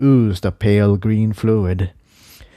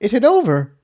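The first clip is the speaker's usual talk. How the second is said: much higher, unsure voice; Tiptoe asking; 11 semitones higher, faster than usual. Acted. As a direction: ask hoping it's over. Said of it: "Tiptoe asked".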